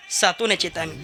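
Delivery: low, soft, humble reply; meek dead man. Acted. Dead soul answers Yama's clerk, low and timid.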